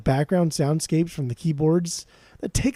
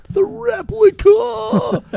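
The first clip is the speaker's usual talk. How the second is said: very high, drawn-out mock shout; 7 dB louder, much slower than usual. Fan imitating a favourite vocal line, over-the-top shout. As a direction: belt it out slowly, hammed up like an obnoxious singer. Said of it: "I love the way he says".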